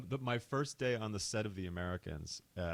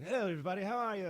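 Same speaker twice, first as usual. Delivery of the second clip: chirpy high-pitched bad British accent; hammed-up British accent, singsong and high; Matthew Rhys accent; 13 semitones higher, faster than usual. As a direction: do a very chipper, badly faked British greeting. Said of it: "that's the worst Matthew Rhys accent".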